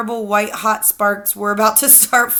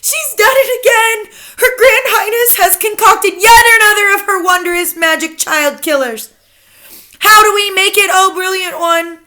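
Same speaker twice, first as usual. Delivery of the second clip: high, loud excited witch chorus; high loud adoring witches; 12 semitones higher, 8 dB louder than usual. A narrator's reading. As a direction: gush over their leader. Witch audience praising.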